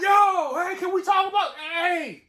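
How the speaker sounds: loud, high "hey" shouts after a car; calling out. Retold or imitated. Husband left in parking lot, shouting after wife's car. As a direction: yell after someone driving away. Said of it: "I'm out there like".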